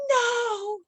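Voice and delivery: high, drawn-out no; protesting no. Acted. Coach refuses to silence the voices, high protesting cry. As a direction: wail a long, high objection.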